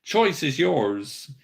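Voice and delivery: ordinary talk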